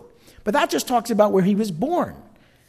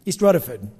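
North Jersey "East Rutherford", lower and R-less; North Jersey accent; 4 semitones lower than usual. Shown as a demonstration. Preacher showing how his hometown said it, North Jersey accent. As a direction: repeat "East Rutherford" as "Ruthafud", Jersey style. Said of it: "we used to say East Rutherford".

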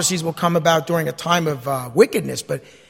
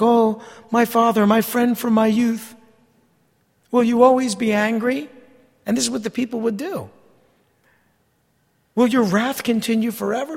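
a hint of whining, pleading sinners; pleading people; 6 semitones higher than usual. Preacher mimicking the people begging God, lightly whining. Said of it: "they say things like".

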